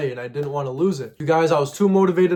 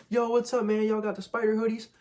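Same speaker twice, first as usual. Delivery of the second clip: put-on a higher, quieter kid asking casually; 5 semitones higher, 5 dB quieter than usual. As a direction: play the young kid at the window. Kids walk up to car window.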